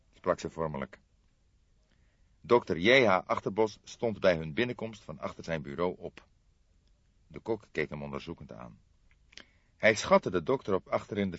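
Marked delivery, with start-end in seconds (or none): none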